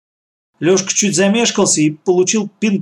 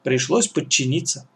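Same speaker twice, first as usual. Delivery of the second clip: ordinary delivery, nothing marked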